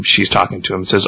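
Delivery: plain reading voice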